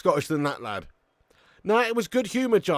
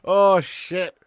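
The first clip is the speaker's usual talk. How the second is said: ordinary delivery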